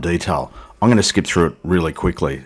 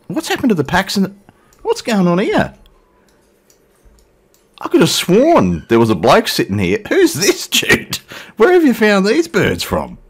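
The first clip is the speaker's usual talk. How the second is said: high, playful disbelief; mock surprise; 12 semitones higher than usual. Pilot teasing passenger Jude about new company, mock surprised. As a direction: pretend to be shocked at who's sitting there.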